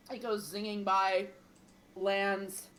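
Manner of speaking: ordinary talk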